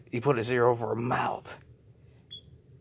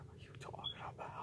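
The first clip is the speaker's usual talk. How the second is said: fast whispered echo; whispered repeat; 19 dB quieter, much faster than usual. Acted. J J echoes his denial to accusers in a fast whisper. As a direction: hiss the same line under breath.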